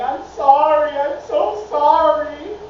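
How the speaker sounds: imitating a sobbing apology, loud and slow; mock bawling. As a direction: ham up a crying cashier's apology. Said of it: "she's bawling and she's just saying".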